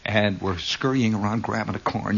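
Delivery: ordinary talk, nothing marked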